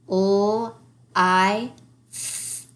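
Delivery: L I F drawn out sound by sound; teacher slowly sounding out for children; slow segmenting. Shown as a demonstration. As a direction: stretch each sound slowly. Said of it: "saying each sound in the order".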